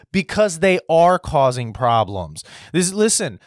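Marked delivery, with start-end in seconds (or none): none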